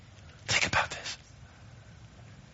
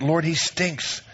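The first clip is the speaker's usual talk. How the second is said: slow blunt quote; 6 semitones higher, much slower than usual. Retold or imitated. Martha's blunt retold line.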